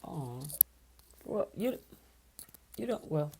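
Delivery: barely able to speak, genuinely touched; overwhelmed and quiet. Real, not acted. Moved by a donation offer.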